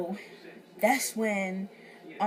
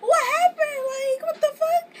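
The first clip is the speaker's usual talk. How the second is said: much higher, louder, cracking disbelief; shocked shriek; 9 dB louder than usual. Retold or imitated. Shocked host asking what happened, high and loud. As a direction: squeal it like you just walked into chaos. Her shocked reaction retold.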